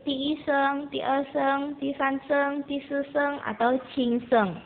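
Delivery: "ti seng, ti e seng, ti san seng, ti si seng, cing seng" spoken as Mandarin tone names, the last one slow. Shown as a demonstration. Teacher naming the Mandarin tones for her pupils, carefully. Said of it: "akan menyebutkan dalam bahasa Mandarin".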